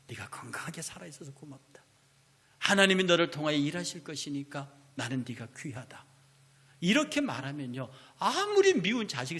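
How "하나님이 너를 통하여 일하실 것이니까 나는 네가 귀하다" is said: ordinary talk, nothing marked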